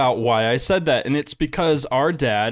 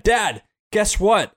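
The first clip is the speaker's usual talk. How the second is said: mild mock shout; 7 semitones higher, much slower than usual. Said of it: "I shout like mad".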